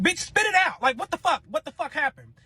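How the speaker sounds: high, loud impatient demand; demanding the boyfriend spit it out. Retold or imitated. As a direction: spit it out, exasperated.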